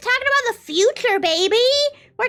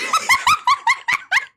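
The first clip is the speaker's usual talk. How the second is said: high burst of giggling; laughing at oneself. Real, not acted. Streamer cracks up at own British accent, high laugh. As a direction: crack up at your own bit.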